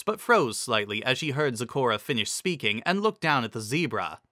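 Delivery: plain reading voice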